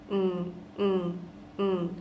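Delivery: closed-mouth hum of the n ending; steady mm hum for the en ending; nasal hum demo. Shown as a demonstration. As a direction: hum the ending through the nose. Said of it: "That's how we're making this ending".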